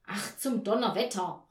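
loud, exasperated outburst; worked-up cursing. A narrator's reading. Mother at the dead radio, worked-up cursing. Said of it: "regte sich Frau Janka auf".

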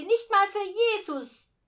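high, very loud curse; outraged outburst. A narrator's reading. Girl jumps up at news of no room, outraged. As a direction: explode with indignation.

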